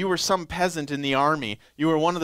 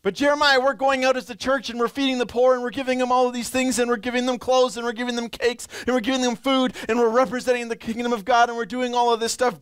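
higher, fast, protesting rush; eager churchgoer; 7 semitones higher, faster than usual. Pretend. A churchgoer hurriedly defending the church's charity to Jeremiah. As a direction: list the good deeds breathlessly.

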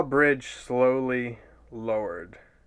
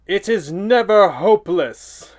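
booming declaration; 5 semitones higher, 8 dB louder than usual. Acted. Wizard's booming entrance line.

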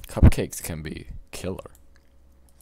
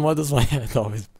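usual speaking voice

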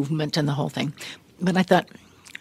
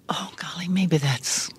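whisper; 5 dB quieter than usual. Retold or imitated. Nervous thought after overhearing director.

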